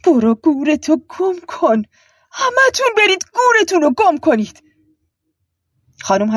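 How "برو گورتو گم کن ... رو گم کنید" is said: high-pitched furious scream. A narrator's reading. Voice inside Zari's head cursing them, screaming. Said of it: "در ذهن زری فریاد کشید".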